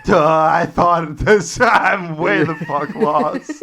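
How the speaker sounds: slow, loud, high dopey voice with a laugh; hammed-up dim-witted Polish soldier; dopey lost soldier. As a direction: play a confused oaf who wandered off. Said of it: "some Polish guy showing up on a boat being like".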